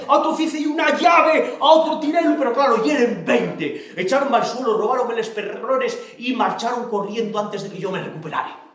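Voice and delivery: alto y fuerte, declamando; orador exagerado. Acted. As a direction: cuenta la pelea como un mitin. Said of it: "como si fuera un orador". (Xico declama la pelea a Manuelona como orador)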